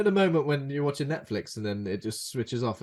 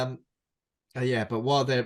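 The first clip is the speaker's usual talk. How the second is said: ordinary talk, nothing marked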